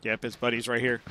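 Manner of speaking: usual speaking voice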